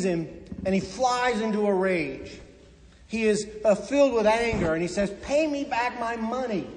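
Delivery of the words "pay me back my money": a higher, angry demand; furious debtor demanding twenty bucks back, higher pitched; mock yell. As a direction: bark the demand at the friend. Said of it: "He yells at him".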